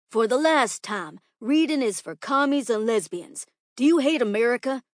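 loud, scolding schoolteacher; irritated teacher. Acted. Teacher rebukes a girl for suggesting reading, loud and irritated. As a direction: lecture the pupil with indignation.

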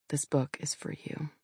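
soft tender whisper. Real, not acted. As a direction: whisper it like a private goodbye. Tender dedication to late father.

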